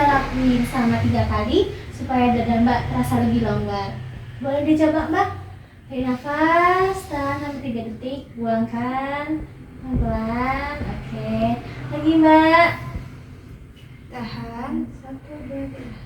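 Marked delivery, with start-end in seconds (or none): 5.9-16.0 guided breathing. Acted. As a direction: talk her through the breaths, dropping almost to a hush.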